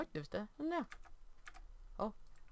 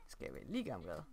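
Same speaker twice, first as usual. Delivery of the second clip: much lower and softer, a goofy mock voice; 12 semitones lower, 8 dB quieter than usual. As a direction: drop the voice low and soft for a joke. Repeats question in odd voice.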